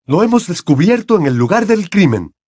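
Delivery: high, frenzied outburst; crazed accusation. A narrator's reading. Crouch wild-eyed, wand on the children, crazed. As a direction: accuse as if half mad. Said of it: "Parecía enloquecido".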